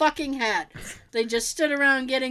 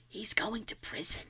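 exaggerated theatrical whisper; breathy whispered secret, no voice; stage whisper; 10 dB quieter than usual. Shown as a demonstration. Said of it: "people talk in an exaggerated stage whisper".

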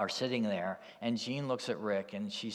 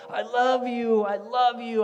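put-on high, loud childlike outburst; acted excited yell, pitched way up; joyful yelling; 13 semitones higher, 12 dB louder than usual. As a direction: shout it out high, overjoyed. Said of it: "and she's yelling".

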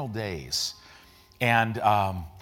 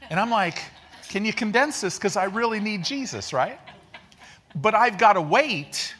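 higher, louder preaching, building excitement; fired-up preaching; 7 semitones higher, 4 dB louder than usual. Acted. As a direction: preach it with rising energy, pitch climbing. Builds an excited sermon illustration.

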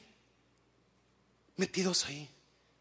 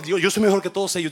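louder, fast, confident affirmation; bold declaration; 5 semitones higher, 13 dB louder, much faster than usual. Shown as a demonstration. Pastor making the church declare it boldly. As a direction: say it out loud like you mean it. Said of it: "No, dígalo".

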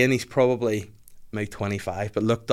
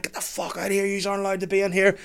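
higher, hammed-up angry rep; mock raging rep; 9 semitones higher than usual. Rep ordering the strangers off the sunbeds, mock rage. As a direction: chase them off, half-joking rage. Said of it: "raging, being like".

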